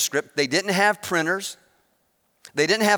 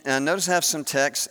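normal talking voice

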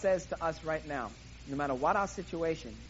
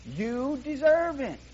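tempting Satan; 5 semitones higher, 4 dB louder, much slower than usual. Acted. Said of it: "Satan is whispering to you".

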